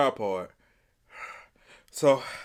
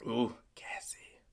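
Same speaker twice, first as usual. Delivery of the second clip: whisper; 9 dB quieter, slower than usual. Real, not acted. Stomach full of gas.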